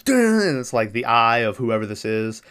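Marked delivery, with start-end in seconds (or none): 0.0-0.4 higher, louder mock horror-movie "dun"; imitated suspense sting; dramatic sting sound. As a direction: punch it like a scary music hit. It does, like, a class.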